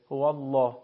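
thick, full L on Allah; heavy L in Allah. Shown as a demonstration. Teacher shows lam after damma or fatha, heavy L in Allah.

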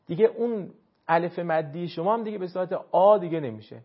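usual speaking voice

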